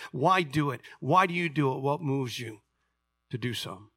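ordinary delivery

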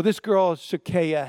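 ordinary talk, nothing marked

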